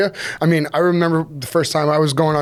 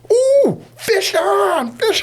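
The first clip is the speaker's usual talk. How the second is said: excited shout; much slower than usual. Retold or imitated. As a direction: yell it out like the line just went tight. Retelling hooking a fish.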